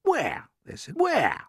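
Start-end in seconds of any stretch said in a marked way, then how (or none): 0.0-0.5 high, urgent question; eager creatures. Acted. Hungry creatures ask James where food is, urgent. 1.0-1.4 high, louder urgent question; eager creatures. Acted. Hungry creatures press James for the food, urgent.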